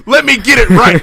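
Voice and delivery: loud high "let me get it right"; loud exclamation. Pretend. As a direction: shout out the correction. Loud correction.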